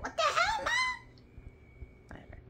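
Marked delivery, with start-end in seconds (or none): 0.0-0.7 much higher squeaky cat voice with a laugh; cat's voice. Pretend. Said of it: "She's like".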